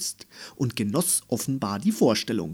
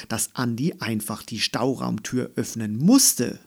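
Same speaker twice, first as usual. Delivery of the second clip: ordinary delivery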